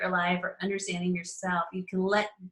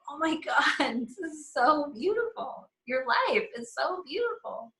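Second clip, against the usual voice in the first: higher, gushing, laughing through it; gushing praise; 7 semitones higher than usual. Acted. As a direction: pour out admiration. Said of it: "my thing is I get to say".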